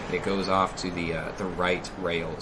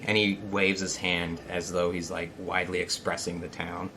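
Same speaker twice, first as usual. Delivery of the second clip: normal talking voice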